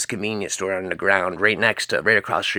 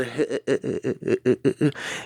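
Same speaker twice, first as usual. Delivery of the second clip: staccato, chuckling cartoon laugh; long stuttering "uh-huh-huh" laugh; crazy Butthead laugh; 3 semitones higher than usual. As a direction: imitate Butthead's dopey machine-gun chuckle. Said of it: "[name] was just couldn't stop laughing".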